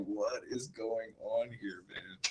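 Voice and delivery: hushed disbelieving whisper; soft slow whisper of shock; whispered quote. Retold or imitated. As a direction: breathe it out as a stunned whisper. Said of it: "I was like".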